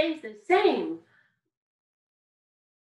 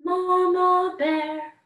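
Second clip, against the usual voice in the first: higher, louder, slow Mama Bear; 5 semitones higher, 6 dB louder, much slower than usual. Shown as a demonstration. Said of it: "is our Mama Bear voice".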